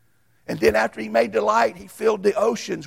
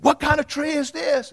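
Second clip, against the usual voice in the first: high, bright quizzing teacher voice, louder; 6 semitones higher, 3 dB louder than usual. Retold or imitated. Man quizzing the Awana kids on a tree. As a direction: quiz the kids with a lifted voice. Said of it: "he said".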